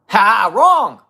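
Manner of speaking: high, loud "Wrong!" called out; loud mocking shout. Real, not acted. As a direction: bark it out like a game show buzzer. Mocking the allies argument.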